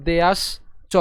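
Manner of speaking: দেয়াস, pause, then চ; broken into parts. Shown as a demonstration. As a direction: detach the final চ from দেয়াস. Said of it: "আমরা ভেঙ-, ভাঙছি".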